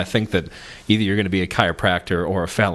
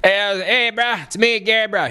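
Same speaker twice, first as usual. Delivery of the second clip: high-pitched caricature of a Texan pal; Gary; 10 semitones higher than usual. Retold or imitated. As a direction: call out like an over-friendly Texan bro. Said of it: "he's from Texas".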